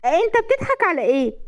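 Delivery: high-pitched affronted question; indignant. A narrator's reading. Wife affronted by husband's laughter, indignant. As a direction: demand to know the joke.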